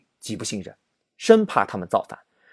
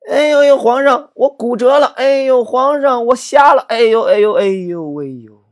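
high, loud fake groans that fade out at the end; hammed-up pained wailing; 10 semitones higher, 6 dB louder than usual. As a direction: generals pile on fake injuries to the emperor. Generals clamour to retire. (夸张地装伤哀嚎，最后渐弱)

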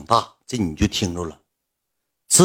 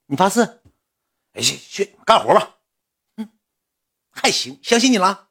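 higher, coy and probing; suspicious assistant; 9 semitones higher than usual. Retold or imitated. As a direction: tease him into swearing it. Assistant quizzing him, teasing.

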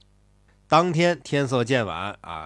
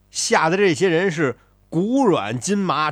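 ordinary delivery, nothing marked

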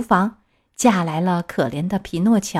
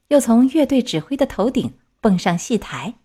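ordinary delivery

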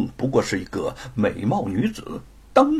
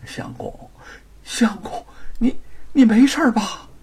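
worried; 10 semitones higher than usual. A narrator's reading. Wife rushes to fallen husband.

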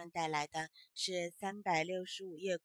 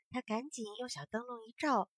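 plain reading voice

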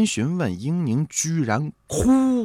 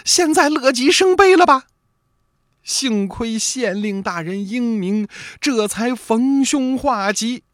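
put-on high, loud, stern scolding old lady's voice; stern mother-in-law; 11 semitones higher, 4 dB louder than usual. A narrator's reading. Mother-in-law scolds Yingning after the court trouble, stern and high. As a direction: scold her sharply in a high old woman's voice. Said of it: "很严肃地对英宁说了".

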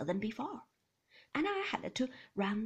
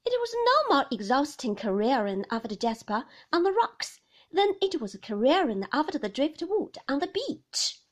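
higher and louder retort; defensive young wife; 4 semitones higher, 13 dB louder than usual. A narrator's reading. Young wife retorting to Maxim, higher and louder. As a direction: answer back, stung.

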